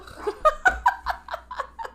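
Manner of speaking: squealing airy laugh; high breathy laugh. Real, not acted. Laughing at pickle joke.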